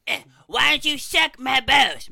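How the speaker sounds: loud slow shout. Shown as a demonstration. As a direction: belt it out slowly as the corrected take. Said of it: "Much better".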